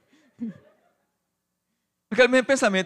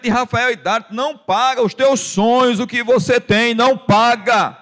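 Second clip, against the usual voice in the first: building from raised to ringing loud preaching; preaching at full force; 6 semitones higher, 6 dB louder than usual. Acted. Pastor urging the congregation to dream bigger, building to a loud peak. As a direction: drive it home louder with every line.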